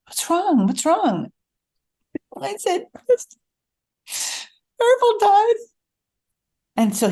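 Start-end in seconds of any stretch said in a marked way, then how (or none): 0.1-1.3 high, worried retold question; alarmed. Husband finding his wife crying, alarmed. 4.8-5.6 hammed-up tearful high squeak; crying. Tearful wife breaking news to husband, crying. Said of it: "So I'm still crying".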